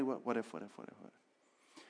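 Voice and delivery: what ifs fading to a whisper; fading anxious loop. Acted. Pastor voicing anxiety's endless questions, fading away. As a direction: let the worry spiral trail off into nothing.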